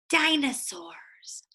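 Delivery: usual speaking voice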